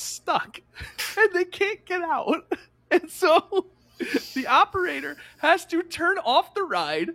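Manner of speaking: telling it through laughter, pitched high; high, laugh-filled storytelling; real amusement. Real, not acted. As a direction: struggle to narrate while cracking up. Cracking up while telling the story.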